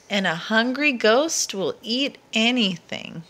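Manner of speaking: ordinary delivery, nothing marked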